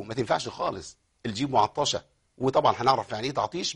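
ordinary talk

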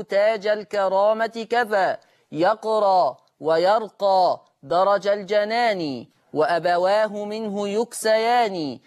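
measured chanted recitation of verse; formal recited opening. Acted. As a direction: recite it like a solemn preface. Formal opening and recited didactic poem.

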